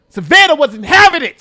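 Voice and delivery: ordinary delivery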